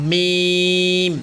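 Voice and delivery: মিম drawn out on a level tone; careful letter demonstration. Shown as a demonstration. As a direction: hold the "ee" of মিম very long. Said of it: "আপনারা উচ্চারণগুলো খেয়াল করবেন".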